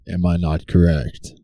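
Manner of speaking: ordinary delivery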